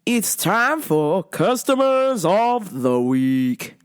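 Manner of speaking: slow, drawn-out segment announcement; announcer voice. Acted. Announcing the Customers of the Week segment slowly. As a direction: announce it like a show title.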